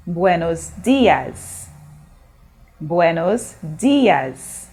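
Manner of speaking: buenos dias said very slowly, each syllable clear; slow modelled Spanish. Shown as a demonstration. Teacher modelling a new greeting for her class, very slow. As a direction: stretch out buenos dias for learners. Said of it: "In Spanish, good morning is".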